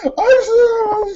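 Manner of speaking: very high, hammed-up tearful wail; mock hysterics. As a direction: imitate an actress falling apart. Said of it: "she's getting madder and madder".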